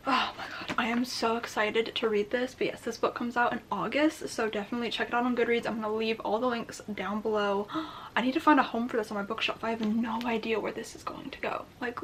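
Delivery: ordinary talk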